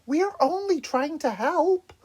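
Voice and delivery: high, pleading voice. Acted. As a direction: plead with him. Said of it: "Donatello pleaded".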